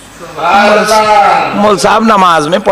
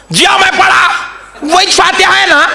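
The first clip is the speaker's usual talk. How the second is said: retold indignant shout; 13 semitones higher than usual. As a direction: shout back in self-defence. Indignant reply in retold argument. (ऊँची, झुंझलाई हुई चीख)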